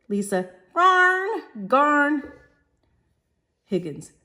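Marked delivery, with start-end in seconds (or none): 0.8-2.3 high, loud Cockney Garn; a shrill street-girl garn; Cockney scoff. Acted. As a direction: scoff it like a London flower girl.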